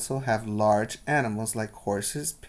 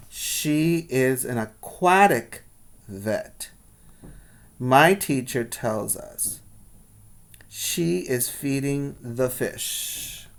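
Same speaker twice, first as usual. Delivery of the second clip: put-on lively read-aloud for children; storybook reading voice; story narration; 5 dB louder, slower than usual. A narrator's reading. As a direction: tell the story brightly to the class. Teacher reads picture book aloud.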